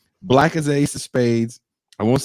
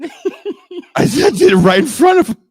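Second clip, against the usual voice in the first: excited; 6 dB louder than usual. Real, not acted. Excited retelling.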